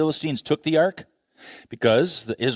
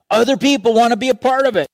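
excited; 10 semitones higher, 6 dB louder, faster than usual. Real, not acted. Preacher building excitement.

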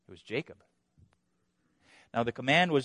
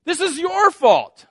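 high, slow, loud accusation; brash blame; 13 semitones higher, 10 dB louder, slower than usual. Acted. Brash husband blaming his wife, high loud accusation. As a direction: point the finger hard. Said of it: "so brash a husband and to say".

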